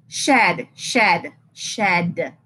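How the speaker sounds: "Shed" said slowly and clearly with a short e, not the S word; careful pronunciation. Shown as a demonstration. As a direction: land on "Shed" crisply, each one falling. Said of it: "Not the S word".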